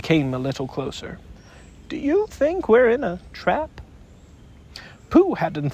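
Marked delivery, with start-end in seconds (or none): 1.9-3.8 mildly nervous Piglet. Acted. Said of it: "he went on nervously".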